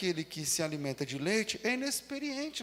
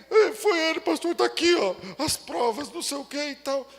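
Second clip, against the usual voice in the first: accusing churchgoer; 6 semitones higher, 7 dB louder than usual. Retold or imitated. Quarrelling brothers accusing each other.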